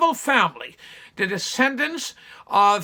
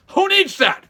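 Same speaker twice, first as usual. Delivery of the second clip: ordinary talk, nothing marked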